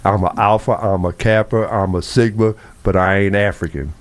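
ordinary talk

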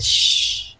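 soft drawn-out shush; long, soft "shh"; retold shush. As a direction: hush someone quietly. Hushing mother to avoid laughing.

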